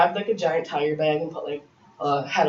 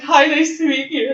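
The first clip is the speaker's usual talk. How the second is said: greeting while bawling; 10 semitones higher, 6 dB louder than usual. Retold or imitated. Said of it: "And I'm, like, bawling".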